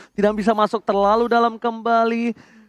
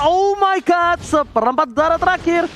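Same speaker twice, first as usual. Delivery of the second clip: excited; 9 semitones higher than usual. Real, not acted. Turret nearly falls, caster reacts.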